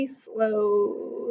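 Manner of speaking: plain reading voice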